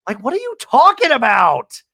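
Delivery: high, loud mock outburst; exasperated yell. Host's exasperated reaction to quote.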